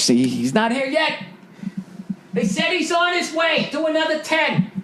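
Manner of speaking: lightly voiced stalling speaker; a hint of Trump stalling for time; mock Trump. Imitating Trump's warm-up voice.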